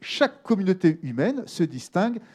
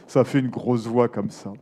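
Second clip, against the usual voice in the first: low voice placed in the neck; big deep voice; 7 semitones lower than usual. Shown as a demonstration. Teacher explaining vibration in the neck, deep big voice. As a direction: let it drop low into a big voice.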